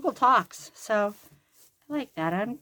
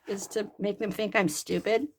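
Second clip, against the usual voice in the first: usual speaking voice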